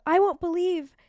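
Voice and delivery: ordinary talk, nothing marked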